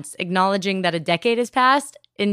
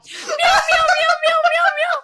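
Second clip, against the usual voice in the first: high, pleading string of meows; cat crying for help; 4 dB louder, slower than usual. Pretend. Co-host mimicking a cat's cry for help, pleading meows. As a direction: meow as a desperate cry for help. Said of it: "That's like you crying for help".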